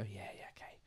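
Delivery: whispered, voiceless brush-off; mock whisper. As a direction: breathe it out like a customer dodging you. Customer brushing off advice, whispered.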